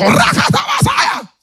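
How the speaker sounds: sudden very high, loud shouted burst of tongues; speaking in tongues. Retold or imitated. Pastora shouting in tongues over the pastor, piercing and loud. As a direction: explode into a shrieking tongues outburst. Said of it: "comenzó a hablarle en lengua".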